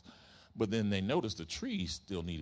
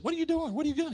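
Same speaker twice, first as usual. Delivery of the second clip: much higher, outraged cry; upset shout; 5 dB louder, faster than usual. Retold or imitated. Homeowner finding workers in his yard, high outraged cry. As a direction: yelp it in disbelief at the workers. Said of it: "he's upset".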